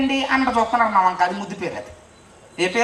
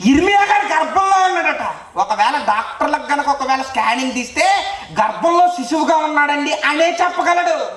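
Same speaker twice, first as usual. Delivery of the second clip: fiery preaching; 5 semitones higher than usual. Acted. Preacher marvelling to the crowd at Peter's tears, fiery. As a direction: proclaim the wonder with full force.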